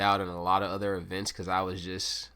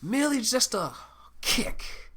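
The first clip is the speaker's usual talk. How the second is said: Merely much higher, then kick breathed out hard; emphasis on kick; 13 semitones higher than usual. Retold or imitated. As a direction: punch the word kick. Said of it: "put a lot of emphasis on that kick".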